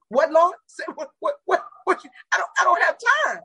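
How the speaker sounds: high, sputtering disbelief; flustered protest. Retold or imitated. Telling God she has no time, high flustered sputter. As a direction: stammer out a panicked no.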